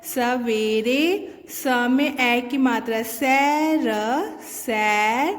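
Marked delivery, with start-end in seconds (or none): 0.0-1.3 "सवेरे" slowly drawn out; sounding out words. Shown as a demonstration. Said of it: "वह बड़े सवेरे".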